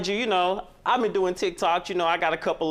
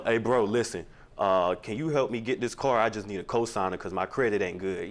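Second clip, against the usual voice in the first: much lower, lazy slurred mock brother; brother's voice; 8 semitones lower than usual. Man mimicking his brother calling for a favor, low lazy mock voice. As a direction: drop the pitch and slur like a brother asking a favor. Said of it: "Did he talk like this?".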